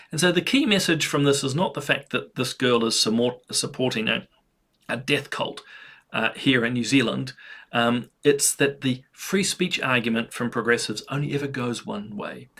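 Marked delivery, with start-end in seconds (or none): none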